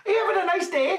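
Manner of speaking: very high, loud chirpy monkey greeting; excited monkey. Acted. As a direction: squeak it out like an overjoyed little monkey.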